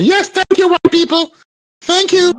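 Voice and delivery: high-pitched, put-on grateful voice; sarcastic impression of grateful people; mock gratitude. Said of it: "And they say".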